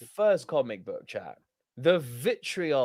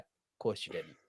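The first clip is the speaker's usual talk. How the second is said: low, soft, dry sarcasm; a muttered mock reply, deadpan and lower; sarcastic reply; 9 semitones lower, 12 dB quieter than usual. Host answering doubters with low sarcastic mock reply. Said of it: "It's like".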